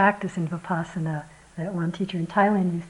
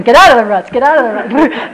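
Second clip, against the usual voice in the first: louder, urgent retold yell; imitated shout; 3 semitones higher, 12 dB louder than usual. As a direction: shout to steer the car out. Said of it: "He's going".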